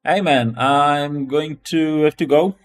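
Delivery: ordinary talk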